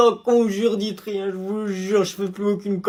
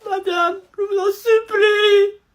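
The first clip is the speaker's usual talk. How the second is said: supplier en pleurant; 9 semitones higher, 6 dB louder, much slower than usual. Acted. Élève en pleurs supplie la prof.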